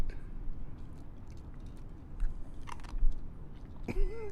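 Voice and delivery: high airy giggle; breathy giggling. Real, not acted. Dog takes treat oddly.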